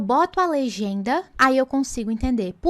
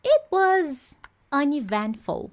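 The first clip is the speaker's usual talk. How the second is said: "It was uneventful" very slow, higher; slow demo; 4 semitones higher, much slower than usual. Shown as a demonstration. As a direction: draw out the example. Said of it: "ela foi de tal forma".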